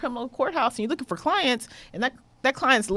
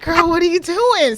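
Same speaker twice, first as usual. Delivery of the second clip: louder, laughing casual greeting; laughing friend; 6 semitones higher, 7 dB louder than usual. Retold or imitated. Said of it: "she goes".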